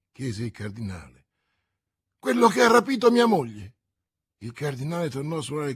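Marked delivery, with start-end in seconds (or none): 2.2-3.8 agitated accusation. A narrator's reading. Bonacieux accuses the newcomer.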